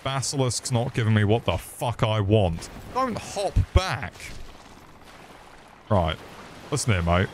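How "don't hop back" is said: slow, high-pitched protest; high exasperated plea. Real, not acted. Gamer protesting at a frog hopping back, high drawn-out exasperation. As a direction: stretch it out high in frustration. Pleading with game enemy.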